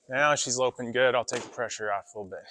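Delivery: usual speaking voice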